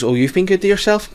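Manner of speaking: lightly voiced old woman, pitched high; high-pitched teasing retold; mock teasing old woman. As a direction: imitate a cheeky older woman's high-pitched tease. Cheeky old woman teasing him.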